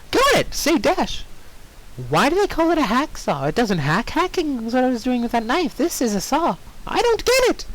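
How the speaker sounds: high, bubbly cartoon pony voice; squeaky pony girl; Pinkie Pie voice. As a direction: chirp it like a cheerful pony.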